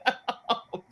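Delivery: high, slow jab through laughter; laughing taunt. Retold or imitated. Host jokingly telling someone they need help, laughing. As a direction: crack up while throwing it back.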